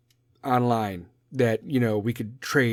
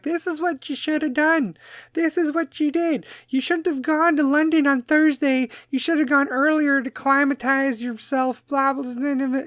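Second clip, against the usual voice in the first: very high, whiny mocking voice; mock know-it-all critic; 14 semitones higher than usual. Mimicking social media critics of the Bears, high mocking voice. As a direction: mimic online scolds in a squeaky pitch.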